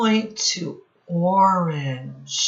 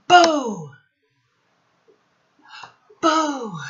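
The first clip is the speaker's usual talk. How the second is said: high, loud mock scare; playful boo; 10 semitones higher, 6 dB louder than usual. Teacher playfully scaring the children. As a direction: jump out at them.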